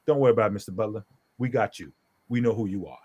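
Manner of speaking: low, settled officer voice; calm reassurance. Retold or imitated. Desk sergeant telling the father not to worry, calm reassurance.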